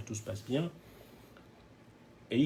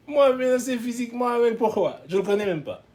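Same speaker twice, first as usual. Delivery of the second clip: much higher and louder, outraged disbelief; accused of threatening a cop, he erupts in disbelief; indignant outrage; 11 semitones higher, 7 dB louder than usual. Real, not acted. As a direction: explode in disbelief at the charge.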